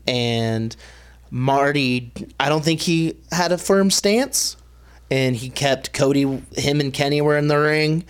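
ordinary talk, nothing marked

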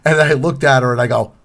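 ordinary delivery

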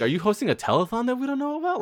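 high, fast mock-indignant question; Ruben impression. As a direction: fire it off high-pitched, imitating an exasperated heckler. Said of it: "I like how Ruben's like".

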